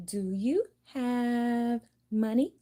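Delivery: high, slow, drawn-out question; mock go fish asker. Playing go fish, asking the other player for a card, high and drawn out.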